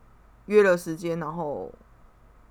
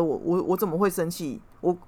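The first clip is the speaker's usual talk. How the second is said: ordinary talk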